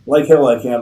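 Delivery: captain shouting defiance at Schiller; defiant shout. Acted. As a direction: throw it back at him as you fire. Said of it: "Rifkin shouted".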